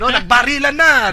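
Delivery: loud, high storyteller's outburst; dramatic shout. Retold or imitated. Host acting out a story that jumps straight to the climax, shouting. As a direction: blurt the shocking climax out loud. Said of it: "Hindi yung agad nandun ka sa climax".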